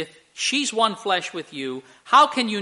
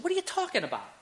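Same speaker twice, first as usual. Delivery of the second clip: high, softer retold disbelief; mock incredulity; 10 semitones higher, 6 dB quieter, much faster than usual. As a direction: act out a baffled husband. Acted husband baffled by advice.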